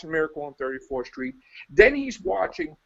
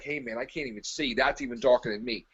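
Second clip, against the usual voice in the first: low, hushed voice of darkness personified; a lightly voiced darkness, dropped low and soft; Dark itself talking; 8 semitones lower, 4 dB quieter than usual. Darkness admitting it can't see, a hint of hushed awe. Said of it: "Dark's like".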